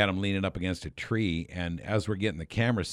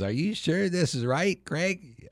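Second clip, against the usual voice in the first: retold in a much higher voice; doubtful query; 10 semitones higher, slower than usual. Actor querying his scripted line with the director, doubtful imitation.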